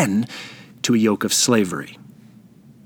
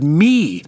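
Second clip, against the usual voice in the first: me set apart and leaned on; single out the word me; stressed me; 3 semitones higher, 3 dB louder than usual. Shown as a demonstration. Preacher repeating the key word for the congregation, with emphasis. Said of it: "The word".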